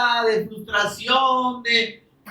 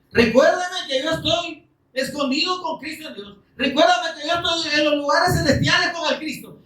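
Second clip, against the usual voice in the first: súplica elevada; 9 semitones higher than usual. Acted. Oración citada del salmo.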